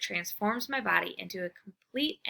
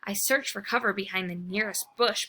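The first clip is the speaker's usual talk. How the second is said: ordinary delivery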